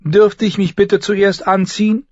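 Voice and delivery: put-on high girlish request; girl calling back politely. Girl asks septa for time to dress, calling politely. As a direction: ask politely in a high, carrying voice.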